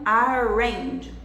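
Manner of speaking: "arrange" with a smooth English R. Shown as a demonstration. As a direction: model "arrange" with the R of English, not an H. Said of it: "do R do inglês".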